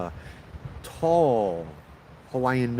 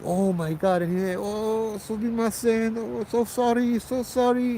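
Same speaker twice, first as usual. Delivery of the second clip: much higher, panicked sorry; startled apology; 12 semitones higher than usual. Retold or imitated. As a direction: babble apologies in shock.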